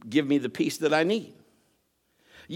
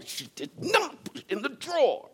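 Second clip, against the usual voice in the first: put-on a little girl's insistent voice, pitched higher; imitating a stubborn daughter, playfully; animated daughter; 9 semitones higher, 4 dB quieter than usual. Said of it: "she's very animated".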